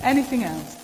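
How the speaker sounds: ordinary talk, nothing marked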